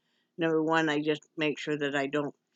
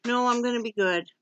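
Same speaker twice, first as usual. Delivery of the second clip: retold protest; 6 semitones higher, 3 dB louder than usual. Retold patient's reply to her question.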